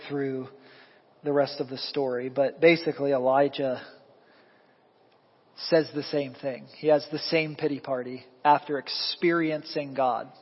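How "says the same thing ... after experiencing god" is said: ordinary talk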